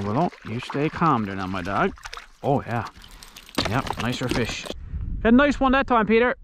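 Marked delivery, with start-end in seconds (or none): 5.2-6.3 calling out. Real, not acted. Calling to Peter across pond.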